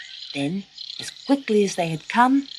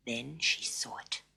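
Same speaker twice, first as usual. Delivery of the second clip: soft breathy whisper; suspense whisper; 5 semitones lower, 12 dB quieter than usual. A narrator's reading. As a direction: hush it out with dread. Suspense narration.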